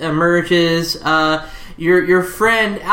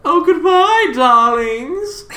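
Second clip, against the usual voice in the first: flamboyant farewell; 9 semitones higher, much slower than usual. Acted. [name] bidding farewell.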